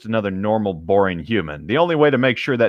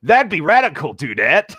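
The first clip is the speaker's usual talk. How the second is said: surfer-dude Streaks; 5 semitones higher, 3 dB louder than usual. Acted.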